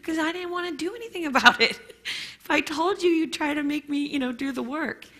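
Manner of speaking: defensive protest. Retold or imitated.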